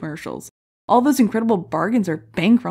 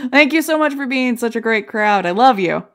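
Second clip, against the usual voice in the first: calling out thanks; 4 semitones higher, 6 dB louder than usual. Real, not acted.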